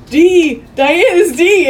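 high, loud, urgent reveal; retold frantic revelation. [name] explains to Eddie in the corridor, frantic.

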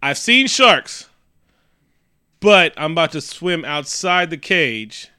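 high, loud, slow mock bravado; mock brave diver. Mocking the sister's plan, high slow bravado. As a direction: drag it out like a dumb heroine. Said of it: "So the bitch is like".

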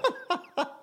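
squeaky cracked laugh jumping way up; voice cracking up an octave. Real, not acted. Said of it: "my voice just cracked".

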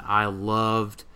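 ordinary talk

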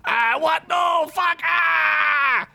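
very high, terrified shouting; panicked scream. Acted. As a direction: scream as they swarm him. Vampires attack Boris.

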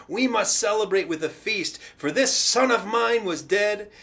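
loud, excited father calling out; urgent, jubilant orders. Acted. Father to his servants as his lost son returns, jubilant urgency. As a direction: order the feast loudly and urgently, overjoyed.

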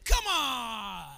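loud, high, disgusted shouting about dirty water; indignant rant. Acted. Naaman refusing to wash in the Jordan, loud high angry shout. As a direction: scoff and shout like an insulted general. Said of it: "that's my translation".

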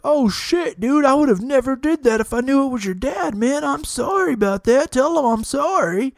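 high, squeaky backpedalling; sheepish apology. Retold or imitated. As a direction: grovel like a scared tough guy. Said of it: "And he's like".

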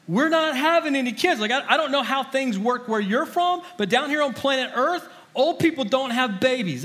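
incredulous mock retort. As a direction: scoff at the angel like it's absurd. Mocking the angel's news.

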